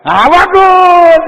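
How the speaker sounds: slow, high and loud "Nah, bagus"; a preacher praising the congregation, slow, high and loud; drawn-out praise. Acted. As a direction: stretch it out high and loud in delight. Praising the audience.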